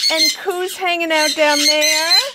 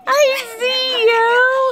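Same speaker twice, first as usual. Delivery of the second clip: slow, higher, drawn-out; peekaboo pet talk; 7 semitones higher, much slower than usual. Real, not acted. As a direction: sing it out like peekaboo. Peekaboo with her bird.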